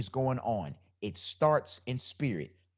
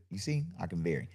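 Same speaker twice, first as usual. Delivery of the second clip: ordinary talk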